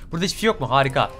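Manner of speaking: ordinary talk, nothing marked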